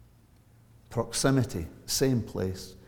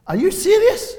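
incredulous officer; 10 dB louder than usual. Retold or imitated. Officer disbelieves the reverend claim.

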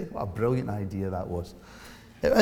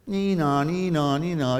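police siren; 5 semitones higher than usual. Retold or imitated. Police car pulls him over.

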